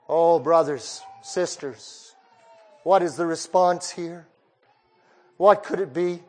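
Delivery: ordinary talk, nothing marked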